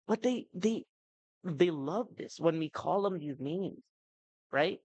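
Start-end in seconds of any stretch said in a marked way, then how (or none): none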